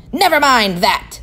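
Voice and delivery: stern teacher snapping at the class; snapped. Acted. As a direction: bite off the question. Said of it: "snapped Miss Swamp".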